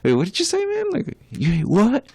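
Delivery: playing back his own shock, pitch jumping up; stunned disbelief. Retelling how he reacted to the fan's slur, in stunned disbelief. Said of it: "I was like".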